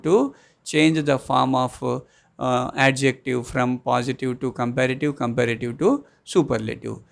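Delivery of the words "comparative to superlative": ordinary talk, nothing marked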